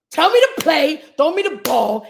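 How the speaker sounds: usual speaking voice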